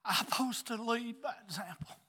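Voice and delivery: high, impassioned preaching voice. Acted. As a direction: lift it up like a revival sermon. Preaches at full force.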